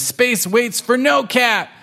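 high, heroic kitten declaration; high-pitched mock battle cry; gung-ho kitten. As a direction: call it out while zooming away. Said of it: "zooms off and says".

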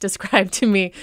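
ordinary talk, nothing marked

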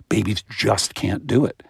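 usual speaking voice

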